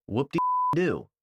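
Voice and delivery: slow, sarcastic cheer. Real, not acted. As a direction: stretch it out slowly in mock celebration. Mocks the claimed benefit of fiber.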